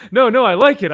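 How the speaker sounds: high, loud backtracking; hasty placating. Retold or imitated. Alien backtracking to an offended Lobo, hastily placating.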